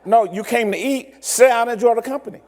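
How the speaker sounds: a hint of a patient host explaining the meal; insisting waiter. The waiter tells the American to sit and talk, lightly voiced. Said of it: "they're like".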